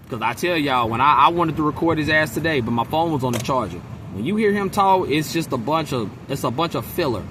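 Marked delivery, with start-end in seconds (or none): none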